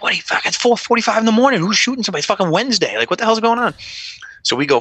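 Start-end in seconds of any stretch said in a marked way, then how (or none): 0.0-3.7 high, fast disbelieving rant; rattled cop's fast, high-pitched disbelief; incredulous outburst. Retold or imitated. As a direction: pitch it up in stunned disbelief at the hour. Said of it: "I'm like".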